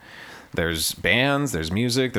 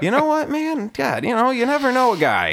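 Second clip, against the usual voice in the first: amused wonder; 13 semitones higher than usual. Real, not acted. Laughing reflection after the story.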